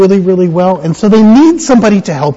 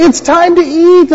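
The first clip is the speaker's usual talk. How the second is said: high singsong shepherd's call; playful high-pitched summons to the flock; 9 semitones higher than usual. Acted. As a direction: call the sheep to dinner in a high voice. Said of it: "And she'll say".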